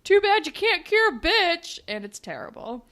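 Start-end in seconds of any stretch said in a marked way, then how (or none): 0.0-1.8 loud, higher, slow mock yell; hammed-up angry shout at the girl. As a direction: spit it at her as a retold yell. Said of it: "he yells at her".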